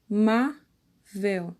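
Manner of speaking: a, má, vel, slowly with pauses between; separated syllables. Shown as a demonstration. Teacher counting out amável's syllables for pupils, split apart. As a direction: break amável into three slow, distinct syllables. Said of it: "a quantidade de sílabas de cada palavra".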